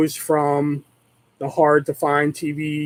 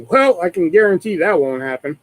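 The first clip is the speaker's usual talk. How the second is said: ordinary talk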